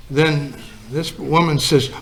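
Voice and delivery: ordinary talk, nothing marked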